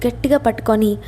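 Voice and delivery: plain reading voice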